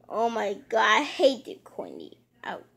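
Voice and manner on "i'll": ordinary delivery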